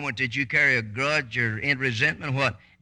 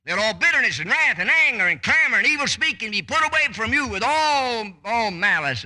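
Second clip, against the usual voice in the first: raised, loud preacher's proclamation; fiery pulpit reading of the verse; scripture at full preaching force; 7 semitones higher, 4 dB louder than usual. Acted. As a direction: proclaim the verse up high and strong.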